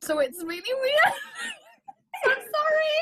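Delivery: high, loud, laughing through the words; laughing apology. Real, not acted. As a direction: break up laughing as you apologise. Laughing over muddled accent, apologising.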